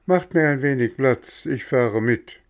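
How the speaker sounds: beaten, but eager now; giving in. Acted. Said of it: "Er war besiegt und sprach".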